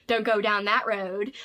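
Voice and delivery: lightly hammed Maine old-timer accent; Judd's Maine accent. Reader quoting Judd from the audiobook, Judd's Maine accent. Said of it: "does the accent for Judd".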